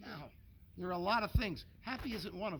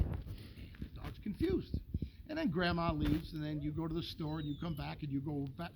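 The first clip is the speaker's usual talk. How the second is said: normal talking voice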